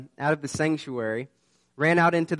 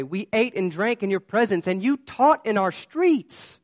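high, slow, pleading voice; desperate pleading; 7 semitones higher, 5 dB louder than usual. Retold or imitated. People shut out begging the master to let them in, high slow plea. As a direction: beg as if the door is shutting on you.

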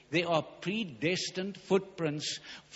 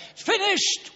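sudden high, loud cry; 6 dB louder than usual. Acted. Jesus on the cross, crying out high and loud. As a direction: cry the words out high above the sermon.

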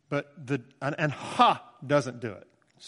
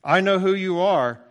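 defiant demon; 4 semitones higher, 8 dB louder than usual. Acted. Demon voiced to Jesus.

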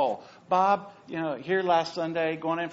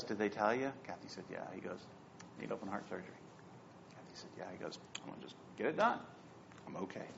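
hushed; 8 semitones lower, 13 dB quieter, much faster than usual. Retold or imitated. Quiet hospital exchange retold.

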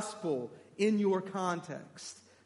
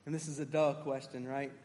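normal talking voice

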